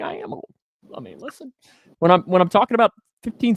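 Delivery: ordinary talk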